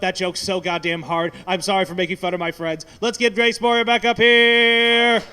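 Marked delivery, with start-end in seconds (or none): 3.0-5.2 announcer call. Acted. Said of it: "introducing host".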